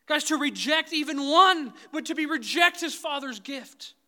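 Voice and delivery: impassioned sermon climax, loud and high; full-force preaching. Acted. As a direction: preach it hard, rising to the rafters. Second surge of impassioned preaching.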